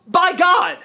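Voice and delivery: fed-up movie fan blurting out; exasperated outburst. Real, not acted. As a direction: throw it up in disbelief.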